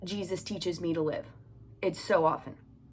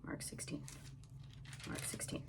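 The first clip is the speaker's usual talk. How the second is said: voiceless whisper, barely audible; 19 dB quieter than usual. Real, not acted. Hiding in the closet from the children, whispering. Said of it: "Because the children are here".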